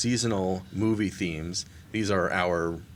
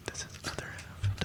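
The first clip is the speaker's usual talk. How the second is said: hushed beer-ASMR whisper; mock ASMR; 14 dB quieter than usual. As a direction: whisper the introduction as playful ASMR. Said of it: "let's do some A S M R".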